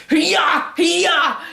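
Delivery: high, loud martial-arts yells; mock battle cry. Girl coming out with a kitchen knife, mock battle cry. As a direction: imitate a wild knife attack.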